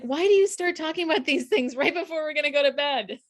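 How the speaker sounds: high-pitched imitated complaint; lightly hammed-up bedtime protest; mock exasperation. Husband objecting to talk before bed, mock exasperated.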